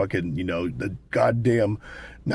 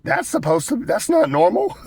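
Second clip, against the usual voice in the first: incredulous; 5 dB louder than usual. Retold or imitated. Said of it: "I'm like".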